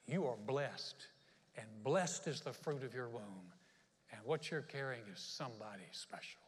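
tender reassurance. Acted. Elizabeth encouraging pregnant Mary, slow tender reassurance.

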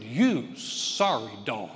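slow, loud, drawn-out mock rebuke; mock scolding preacher. Harsh preacher scolding a drinking member, slow and loud. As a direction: drag out the insult like a stern preacher.

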